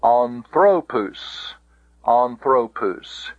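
"Anthropus" slowly, THRO stressed, falling after; "Anthropus" as an-THRO-POOS, long ending; stress on penult. Shown as a demonstration.

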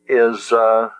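ordinary talk

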